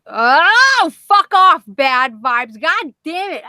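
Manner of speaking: loud, high exasperated groan into cursing; exasperated outburst. Real, not acted. Streamer fed up with a troll in chat, exasperated outburst.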